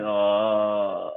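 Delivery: long, soft groan; dazed groan. Retold or imitated. Victim lying there after the punch, dazed groan. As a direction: groan softly, barely conscious. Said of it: "like, kinda unconscious".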